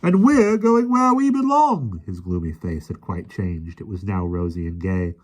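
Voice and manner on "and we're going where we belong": high, slow, loud joyful declaration. A narrator's reading. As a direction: proclaim it with joy. Policeman leaving happily.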